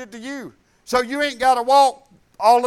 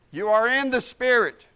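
ordinary talk, nothing marked

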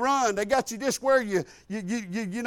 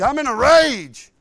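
loud declaration of being in a rage; loud exclaim; 3 semitones higher, 8 dB louder than usual. Real, not acted. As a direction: belt it out, pumped up. Heart racing on stress test.

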